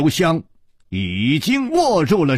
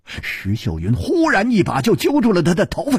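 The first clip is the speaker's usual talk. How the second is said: ordinary delivery, nothing marked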